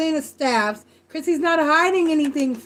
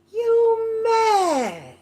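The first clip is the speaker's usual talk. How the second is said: high, drawn-out mocking singsong; teasing; 9 semitones higher than usual. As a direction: tease with a long, high-pitched whine. Teasing the man for being mad.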